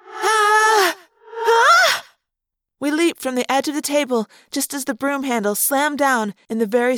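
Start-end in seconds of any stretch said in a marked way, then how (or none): 0.1-2.0 high panicked scream, rising; startled scream. Acted. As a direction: scream as you leap. Leaping off the table in fright.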